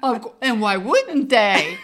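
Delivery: slow, laughing indulgent tone; mock doting husband; patronizing. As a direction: pat her on the head with words. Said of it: "patting me on the head".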